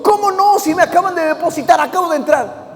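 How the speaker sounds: high-pitched mock indignant customer; indignant protest. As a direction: protest in disbelief, voice jumping up. Cliente protesta por fondos. (protesta indignada, fingida)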